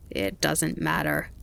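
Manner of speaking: slow rasping grasshopper; rasped. A narrator's reading. Grasshopper brushing off the fawn's apology, rasping. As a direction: rasp it out slowly. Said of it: "the grasshopper rasped".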